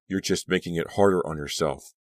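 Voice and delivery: ordinary delivery, nothing marked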